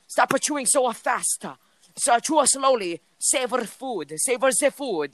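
high mock mom scolding in accent; squeaky imitation of a strict foreign mother. Guy imitating his friend's strict mom scolding at the table.

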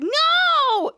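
very high, loud, drawn-out "no"; hammed-up squeal of refusal; acted horrified refusal. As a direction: wail a mock-horrified no. Charlotte refusing the seafood, getting away.

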